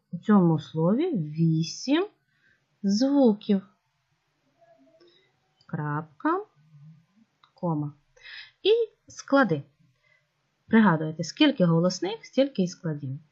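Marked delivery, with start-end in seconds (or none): none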